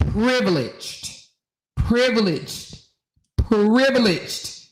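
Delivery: privileged drawn out slowly, each one weightier; stretched repetition. Shown as a demonstration. Host mocking the woman, slow emphatic privileged. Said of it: "it screamed".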